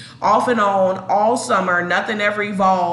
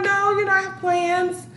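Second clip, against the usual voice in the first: higher, coy and teasing; coy higher plans; 10 semitones higher than usual. Retold or imitated. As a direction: sing it coyly, up high. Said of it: "I'm like".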